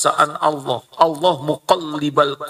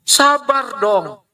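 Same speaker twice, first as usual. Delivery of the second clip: drawn-out, higher, exasperated; impatient; 10 semitones higher, much slower than usual. Retold or imitated. Parent impatiently telling a child to be patient. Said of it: "Katanya nyuruh sabar sambil tidak sabar".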